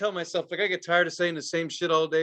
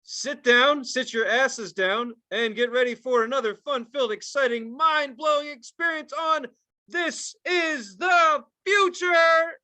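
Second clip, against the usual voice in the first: booming, high announcer voice; game show host; 7 semitones higher than usual. Acted. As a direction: hype the crowd like a host. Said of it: "you were doing the game show voice".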